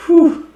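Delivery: high, loud blown "whew". Real, not acted. Learner after a hard word, a loud whew. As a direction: let out a sharp high whew.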